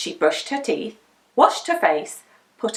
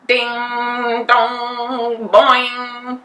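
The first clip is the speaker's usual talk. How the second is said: clock chime; 3 dB louder, much slower than usual. A narrator's reading.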